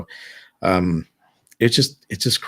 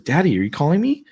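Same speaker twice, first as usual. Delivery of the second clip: put-on high, louder child's voice calling from far off; little daughter calling; 10 semitones higher, 6 dB louder than usual. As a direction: call out like a small girl from another room.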